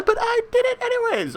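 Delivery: suddenly very high silly voice; high goofy sign-off; squeaky high voice. Acted.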